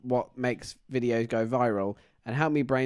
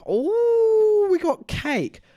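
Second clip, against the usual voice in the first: high, squealing voice of a cheery customer; chirpy customer; 7 dB louder, much slower than usual. Retold or imitated. A customer announcing cake in a high squeal. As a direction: squeal it up high like a delighted old lady. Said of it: "she's like".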